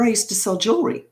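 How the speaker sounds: ordinary talk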